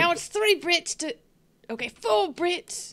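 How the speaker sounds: bad British accent. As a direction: keep up the hammed-up London accent. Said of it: "that's quite a good accent".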